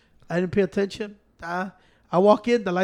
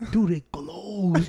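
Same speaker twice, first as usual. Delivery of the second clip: drawn out very slowly in mock awe; slow amazement; 4 semitones lower, 5 dB quieter, much slower than usual. Real, not acted. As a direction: let each word hang, amazed. Marvelling at glowing seat.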